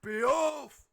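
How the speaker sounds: loud, high shouted name; captain's yell. Acted. Captain summoning a crew member, yelling. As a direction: call out the name at full voice, stretched and high. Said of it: "you hear Day and yell".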